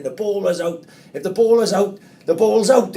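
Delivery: high, loud insistent shout; angry shout. Retold or imitated. Old dad yelling at the coach about the ball, loud and angry. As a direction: shout it like a furious dad behind the goal. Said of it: "my old man's going".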